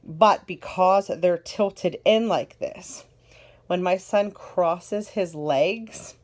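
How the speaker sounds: normal talking voice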